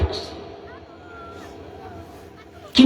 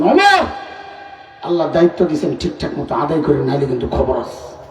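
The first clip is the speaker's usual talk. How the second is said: bold warning; 6 semitones lower than usual. Retold or imitated. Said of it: "better কলিজার সাহস কত".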